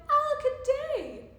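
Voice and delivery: high, loud storybook lament; hammed-up cry of woe. A narrator's reading. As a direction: wail it out theatrically. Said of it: "And he cried".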